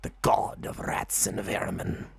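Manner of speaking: slow hushed whisper; whispering rat god. Acted. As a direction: whisper the title slowly, secretive. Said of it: "Rethaniel goes".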